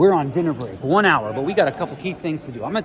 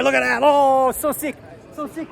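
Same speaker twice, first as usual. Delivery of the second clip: much higher and louder, gushing; hyped; 10 semitones higher, 4 dB louder than usual. Real, not acted. Vlogger greets friends at the table, hyped gush. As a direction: get pumped at the sight.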